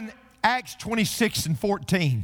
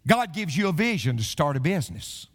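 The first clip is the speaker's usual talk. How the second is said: ordinary talk, nothing marked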